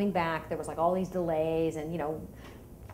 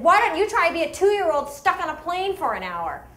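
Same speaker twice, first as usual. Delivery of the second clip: loud, much higher heated comeback; angry retort; 9 semitones higher, 7 dB louder than usual. Retold or imitated. Mom firing back at the passenger, loud heated comeback. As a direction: fire back in exasperation. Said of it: "And I said".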